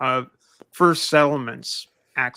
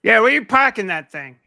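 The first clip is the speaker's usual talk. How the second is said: put-on Boston accent; 7 semitones higher, 6 dB louder than usual. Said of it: "The Boston accent is more like".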